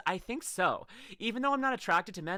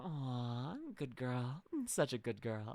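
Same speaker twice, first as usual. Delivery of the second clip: fond cooing; 6 semitones lower, 8 dB quieter, much slower than usual. Real, not acted. Praising Mira fondly.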